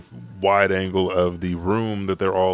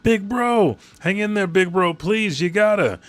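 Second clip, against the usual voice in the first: much higher, loud, fast, panicked pleading; desperate pleading; 11 semitones higher, 5 dB louder than usual. Retold or imitated. Brook begs his dying big brother to hold on, desperate high cry. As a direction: beg him to hang on, voice straining high.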